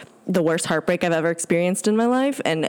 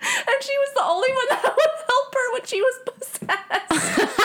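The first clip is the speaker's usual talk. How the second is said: high, teary voice breaking into sobs; real tears spilling through a laugh; crying; 14 semitones higher than usual. Real, not acted. Telling her co-host about the friendship, tearful. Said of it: "Why am I crying?".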